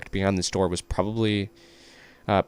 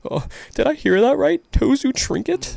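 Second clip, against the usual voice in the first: high character voice; 5 dB louder than usual. Acted. Reading a character's lines aloud.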